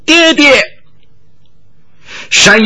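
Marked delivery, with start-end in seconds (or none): 0.1-0.6 very high call for papa; high cry. Acted. Fleeing girl cries out high to her father. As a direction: cry papa high as she falls forward. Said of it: "高叫".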